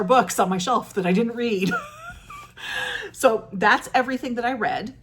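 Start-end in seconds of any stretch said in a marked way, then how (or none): 1.8-3.1 high laugh. Real, not acted. Laughs at herself.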